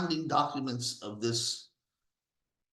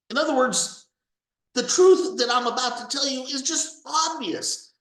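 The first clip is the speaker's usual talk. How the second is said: ordinary talk, nothing marked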